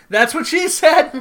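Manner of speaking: loud, high, drawn-out joke catchphrase; hammed-up gleeful quote; imitated punchline. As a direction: crow the punchline slowly and loudly. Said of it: "and he goes".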